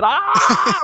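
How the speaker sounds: high, loud scream breaking into a laugh; laughing mock scream. Retold blinded friend, laughing hammed-up scream.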